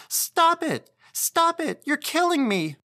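put-on piercingly high, loud child's shrieks; frantic, hysterical child screaming; hysterical shrieking. A narrator's reading. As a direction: shriek in terror for it to stop. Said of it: "she shrieked terrifyingly, hysterically".

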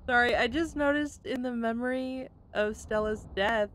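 ordinary talk

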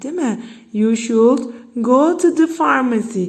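ordinary talk, nothing marked

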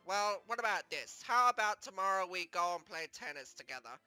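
low, soft coaxing; consoling. Acted. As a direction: gently offer a way to cheer up. Consoles upset friend with offer.

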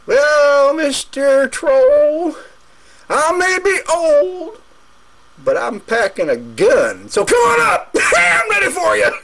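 put-on high, cocky old goat, ending in a shout; tough old goat. Old goat daring the troll to come up, cocky. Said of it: "And the other goat says".

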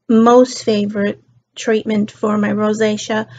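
normal talking voice

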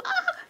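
short high imitation shriek; mock scream. Retelling the distraught housemate's reaction, mock scream. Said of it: "She's screaming and all that".

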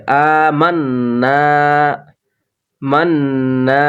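আমান্না and মান্না with the nun hummed through the nose for one alif length, slow; held ghunna. Shown as a demonstration. Teacher drilling the ghunna length, slow nasal hold. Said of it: "এক আলিফ পরিমাণ গুন্না ধরে রেখে".

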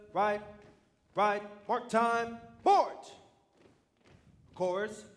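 loud barked marching orders; drill commands. Acted. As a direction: call the drill like a sergeant.

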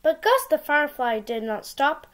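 ordinary delivery

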